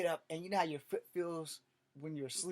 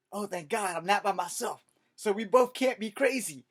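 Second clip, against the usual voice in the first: relieved; 4 semitones higher, 8 dB louder than usual. Retold or imitated. Relieved kid to friend.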